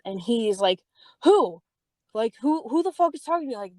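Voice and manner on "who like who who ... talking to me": retold outrage, pitched high; indignant demand. Boyfriend hearing someone cussed her out, indignant demand. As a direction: imitate an incensed boyfriend demanding a name.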